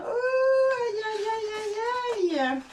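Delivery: high moaning ouches, fading; groaning. A narrator's reading. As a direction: moan as if bruised. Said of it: "en gémissant".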